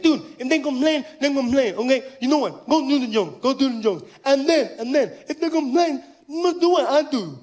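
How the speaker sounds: high imitation of a deaf friend; deaf man's voice. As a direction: coax in a high put-on deaf voice. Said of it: "He's like".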